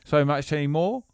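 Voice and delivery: light put-on Nottinghamshire accent; a hint of an English bloke from Nottinghamshire. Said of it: "What part of England are you from".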